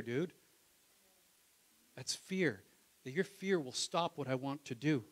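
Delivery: ordinary talk, nothing marked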